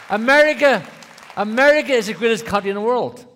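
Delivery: booming shout about America; loud preaching. Real, not acted. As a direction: belt it out like a preacher rallying the room. Preacher shouting patriotic opener.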